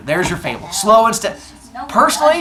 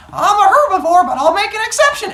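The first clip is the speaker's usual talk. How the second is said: very high, loud cartoon walrus; 6 dB louder than usual. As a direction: put on a high, blustering walrus. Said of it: "the walrus is like".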